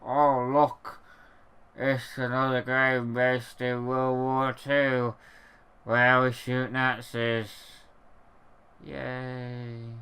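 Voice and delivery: low, deadpan mock enthusiasm; bored sarcasm, voice dropped low; sarcastic. Sarcastic mock excitement about WWII games.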